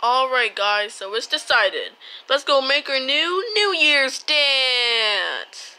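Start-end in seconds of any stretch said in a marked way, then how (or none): none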